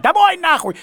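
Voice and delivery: loud, high yell to go home; panicked cry for home. Retold or imitated. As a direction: shout it like you want out now. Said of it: "первый я, конечно, закричал".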